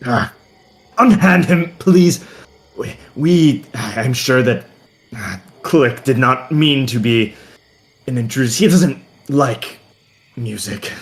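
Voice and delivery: anxious mage smoothing things over; pleading mage. Acted. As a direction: plead for your friend in hesitant bursts. GM plays mage pleading for Click.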